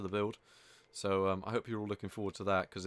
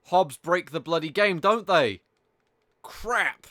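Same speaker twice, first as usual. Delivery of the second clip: loud, higher-pitched real dismay; loud exasperation; 5 semitones higher, 9 dB louder, slower than usual. Real, not acted. Gamer to viewers as Hobbs break the game, loudly exasperated.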